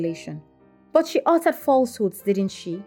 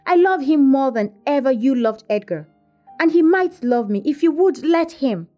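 higher-pitched heated declaration; acted lovestruck defiance, pitched up; impassioned protest; 6 semitones higher, 5 dB louder than usual. A narrator's reading. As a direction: insist on your love against opposition. Infatuated girl argues back.

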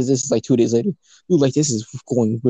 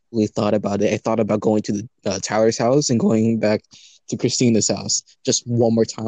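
ordinary delivery

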